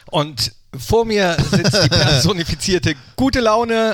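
loud, slow showman intro; slow grand announcer voice. Acted. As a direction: announce him like a gala host.

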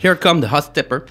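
put-on sing-song English accent in English words. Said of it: "j'ai un accent anglais de ouf".

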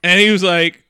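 ordinary talk

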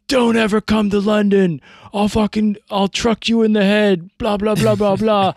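high, loud mocking troll voice; mimicking the troll who threatened him; mock London troll. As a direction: ham up a taunting bully.

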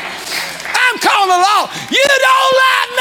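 put-on very high, stretched-out kid wail; hammed-up child protesting at full pitch; mock child tantrum. Imitates child protesting a parent's rule.

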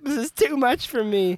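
mock whiny voice. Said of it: "he's like".

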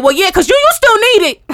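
high, loud mock shout; imitating an oblivious loud caller; too loud reply. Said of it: "Why are you saying".

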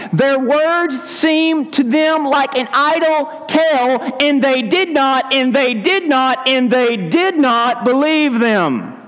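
slow, emphatic Bible reading; slow preached reading; scripture reading. A narrator's reading. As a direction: read it out as proof.

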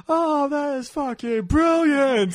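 high, drawn-out mock elation; imitated losing it. Fan imitating the villain watching everybody die, losing it. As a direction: act a cold man suddenly losing it. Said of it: "cold and quiet person's kind of loses shit".

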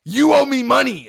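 loud, high pretend shakedown; mock demand. Fan play-acting a threat at a meet and greet, loud mock demand. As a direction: shout it like a play-acted hold-up. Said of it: "What if I said, Zoobs, instead".

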